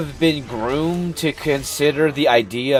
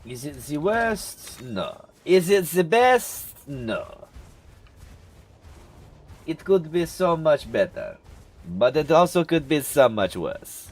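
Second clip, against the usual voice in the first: a light put-on French accent; playful, lightly done French accent; 3 semitones higher, 4 dB quieter than usual. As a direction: ponder it in a hint of French accent. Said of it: "put on the French accent".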